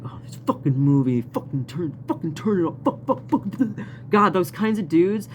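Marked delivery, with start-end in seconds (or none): 0.0-3.4 imitating the dude. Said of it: "be like".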